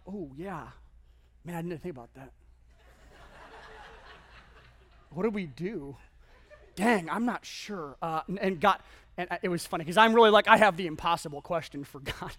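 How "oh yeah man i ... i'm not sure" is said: a hint of a softer, puzzled God, mumbled; imagined stumped God. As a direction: play God caught off guard, lightly. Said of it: "I'm envisioning God like".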